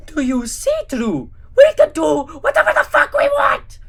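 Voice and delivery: manic Gru. Acted. Gru gloating at Drew over the destruction. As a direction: gloat like a psychopath.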